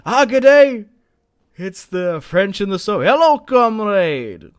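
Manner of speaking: loud, high, hammed-up greeting as the allied troops; mock soldier greeting. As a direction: boom out a cheery pretend hello from the allied troops. Voices the arriving allied troops.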